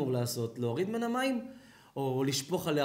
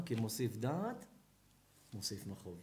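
soft, gentle answer; 7 semitones lower, 7 dB quieter than usual. Acted. Rabbi gently answering a crying woman, softer and lower. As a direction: answer her gently and low.

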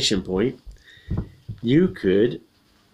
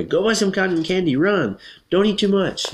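high, rapped-out orders; pitched-up, emphatic commanding; yelled commands; 9 semitones higher, 3 dB louder than usual. Acted. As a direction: yell a string of orders. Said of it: "yells Kate's aunt".

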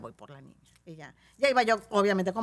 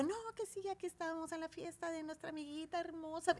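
put-on high, soft kids' host voice; smooth it over sweetly; soft high coaxing; 8 semitones higher, 11 dB quieter than usual. Animadora calmando a la niña. (voz aguda y suave de animadora)

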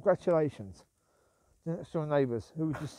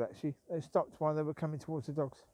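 ordinary delivery

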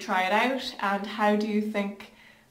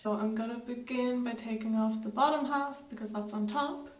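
stiff lips under sheet mask; restricted mouth movement; through a face mask; 2 semitones higher, 4 dB quieter than usual. Real, not acted. Seen 1: Foil mask covers lower face around lips until peeled off near 3.7s.

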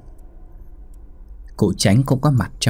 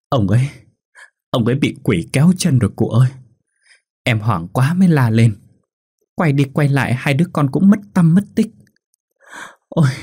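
lightly voiced grieving widow, rising higher and louder into a lament; tearful wail building; 5 semitones higher, 3 dB louder than usual. A narrator's reading. Widow telling the village elder of her husband's death, wailing. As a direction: build from a shaken account to a mock keening wail. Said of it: "nói trong nước mắt".

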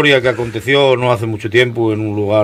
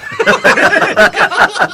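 real burst of high laughter; 6 dB louder than usual. Real, not acted. Teller laughing at his own joke, high laugh. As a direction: crack up at the punchline.

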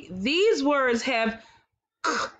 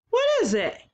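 much higher, sing-song quiz question; playful question; 12 semitones higher, much faster than usual. Acted. Teacher quizzing young children, high and playful.

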